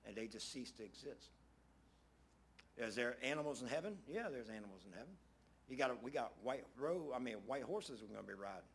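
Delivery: ordinary talk, nothing marked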